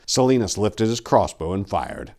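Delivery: usual speaking voice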